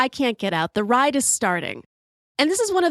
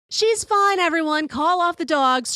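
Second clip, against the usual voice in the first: breezy all-clear; 7 semitones higher than usual. Retold or imitated. Girlfriend waving off worried crew and staff, high and breezy. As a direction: sing it out like it's no big deal. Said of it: "she laughs, throws her arms up in the air, and says".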